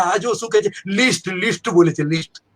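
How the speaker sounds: mild mocking jeer in a lightly changed voice; a hint of a jeering crowd repeating list; mocking youngsters. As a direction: tease the word list like laughing kids. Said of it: "young लड़कियां और young लड़के बोला".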